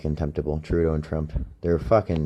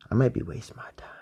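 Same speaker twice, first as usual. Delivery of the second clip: whispered aside; 3 semitones higher, 6 dB quieter, faster than usual. Real, not acted. Aside under the breath.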